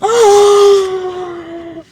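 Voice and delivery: long, high-pitched shocked gasp; high drawn-out gasp; dramatic gasp. Real, not acted. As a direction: gasp in scandalized shock and draw it out. Dramatic reaction to being caught kissing.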